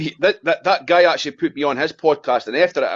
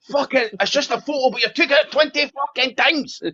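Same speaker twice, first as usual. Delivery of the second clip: higher, fed-up mock rant; exasperated complaint; 8 semitones higher than usual. Mocking his partner's photo habit, exasperated and higher. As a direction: throw your hands up at the endless photos.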